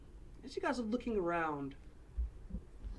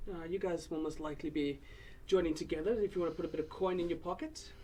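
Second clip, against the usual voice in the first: put-on old dwarf woman; 2 semitones higher, 3 dB louder than usual. Borla asking about the companions, hearty dwarf voice.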